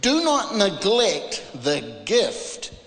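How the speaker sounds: ordinary talk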